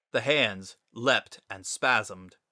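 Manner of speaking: ordinary delivery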